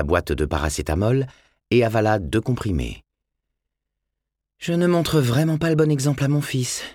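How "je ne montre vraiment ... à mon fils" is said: voix de femme légèrement jouée, plus aiguë; pensée intérieure. A narrator's reading. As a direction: se reprocher à soi-même, un peu lasse. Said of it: "songea-t-elle". (monologue intérieur d'une mère lasse)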